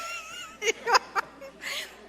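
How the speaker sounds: squealing high laughter, almost crying; helpless giggle; high laughing fit. Real, not acted. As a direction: break up laughing. Laughs at own joke.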